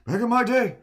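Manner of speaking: put-on high, loud crotchety old-timer; old man voice. Streamer attempts an old man voice for chat, loud and high. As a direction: rant like a cranky grandpa. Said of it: "try to do old man voice".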